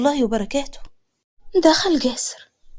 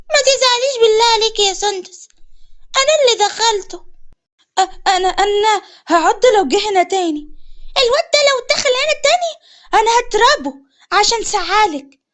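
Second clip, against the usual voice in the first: put-on child's voice; 7 semitones higher than usual. A narrator's reading. Little siblings comfort Sondos.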